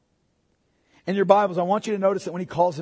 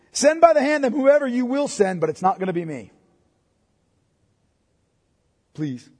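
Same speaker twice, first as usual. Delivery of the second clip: rebellious refusal; 8 semitones higher, 6 dB louder than usual. Shown as a demonstration. Preacher voicing Moses's defiant refusal to God. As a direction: brush God off with a curt please. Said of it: "completely rebellious statement".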